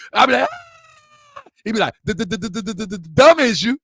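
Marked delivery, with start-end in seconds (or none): 0.5-1.4 thin, very high mock squeal; retold pained scream. Nephew punched in the arm by uncle, mock scream. 2.0-3.8 stammered run-up, then a blurted punchline; machine-gun stutter on the first word, higher; Uncle Pete. Retold or imitated. Imitating his stuttering uncle for the channel, stuttered taunt. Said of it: "my uncle stutter".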